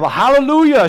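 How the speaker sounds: preacher's sudden hallelujah; joyful hallelujah. Real, not acted. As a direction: burst out in praise. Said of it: "preacher exclaims".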